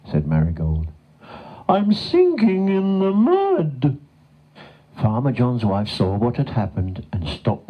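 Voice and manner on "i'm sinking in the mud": high, slow, drawn-out wail; distressed cow wail. A narrator's reading. Cow telling the horse she is sinking, distressed. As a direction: stretch out the plea in a high voice.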